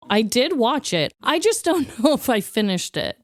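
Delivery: ordinary talk, nothing marked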